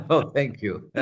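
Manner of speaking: laughing thank you. Real, not acted. Laughs at host's reaction.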